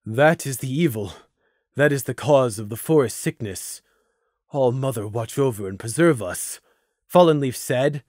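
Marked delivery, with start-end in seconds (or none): 0.0-6.6 understated numb dread; murmured as if stunned by what you saw; lightly acted numb voice. A narrator's reading. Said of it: "voice numb".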